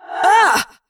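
high, loud "Ah!" as he's hit; startled cry of pain. Acted. Scene: Jake hit by a raindrop, sharp cry. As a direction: yelp at the impact.

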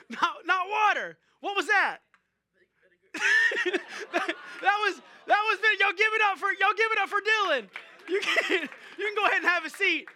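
loud and high, laughing through the words; cracking up at the prank you just pulled on him. Real, not acted. Pastor laughing at prank reveal.

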